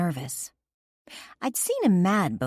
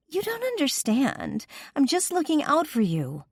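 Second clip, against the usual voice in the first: high pleading woman's voice; begging; 12 semitones higher than usual. A narrator's reading. Kimber clinging to Garrett, begging in a high voice. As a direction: plead with him, pitched high. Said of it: "she began to beg".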